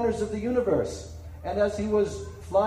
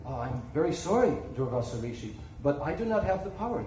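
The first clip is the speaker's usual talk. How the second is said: lower, softer apologetic refusal; regretful Brahma; 7 semitones lower, 5 dB quieter, faster than usual. Acted. Lord Brahma gently refusing the frightened rishi, lower soft apology. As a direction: let him down gently, sorry but firm.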